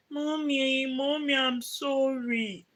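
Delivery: put-on high, slow whimpering child's voice; imitating crying toddler. Mother imitates her crying daughter apologizing, a whimpering child voice. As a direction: mimic the little girl sobbing out an apology. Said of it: "She will be crying and be like".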